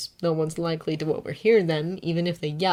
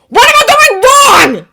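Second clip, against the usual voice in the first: angry; 17 dB louder than usual. A narrator's reading. Bakugo shouting.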